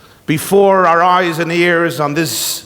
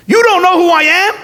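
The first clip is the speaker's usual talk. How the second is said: high, loud incredulous squeal of disbelief; indignant Trump; 12 semitones higher, 4 dB louder, faster than usual. Retold or imitated. Rabbi telling a joke, Trump offended, high and loud.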